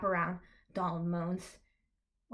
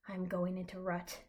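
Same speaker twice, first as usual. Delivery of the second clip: ordinary delivery, nothing marked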